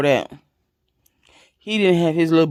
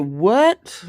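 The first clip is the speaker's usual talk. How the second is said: shocked what; 6 semitones higher than usual. Retold or imitated. Hearing she's pregnant at the clinic, stunned disbelief. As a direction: blurt it out in disbelief.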